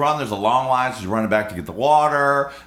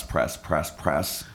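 lower, softer repeated mutter; muttered pressing; 6 semitones lower, 5 dB quieter, much slower than usual. Retold or imitated. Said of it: "jabbing the button".

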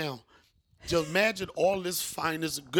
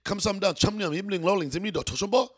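phone-answering routine; 3 semitones higher, 4 dB louder than usual. Retold or imitated. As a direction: rattle off a practised Korean phone greeting, projected. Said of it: "it was a phrase they used to say when they answered the phone".

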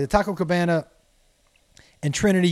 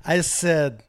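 ordinary delivery

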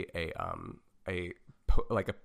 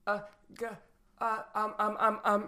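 flustered stammer; 11 semitones higher than usual. Real, not acted. Flustered stalling, pitch jumps high.

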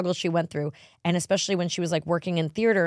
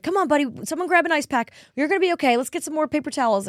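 higher, hurried soothing dad voice; husband soothing child; 10 semitones higher, 4 dB louder than usual. Retold or imitated. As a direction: play the calm dad tending a nosebleed.

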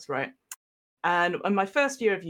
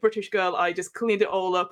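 ordinary delivery

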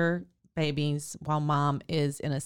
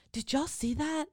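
higher, amazed mock boast; bragging doctor; 7 semitones higher than usual. Doctor boasting to nurses after the birth, high and amazed. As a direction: show off like a thrilled rookie.

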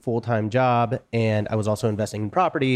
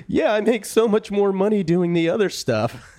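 high, tearful laughing self-imitation; mock crying; 9 semitones higher than usual. Quitting on a Zoom call to her bosses, mock sobbing. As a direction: squeak it out like you're half-crying, half-laughing. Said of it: "started crying immediately".

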